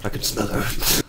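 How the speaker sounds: soft, low echo of a character; hushed imitation; breathy mimicry. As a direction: half-whisper it, low. Echoing the quoted game character.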